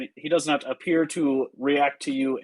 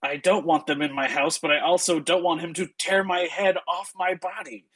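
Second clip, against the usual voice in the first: higher-pitched anxious protest; Ismark's unease; 6 semitones higher than usual. Acted. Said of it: "try and steady himself".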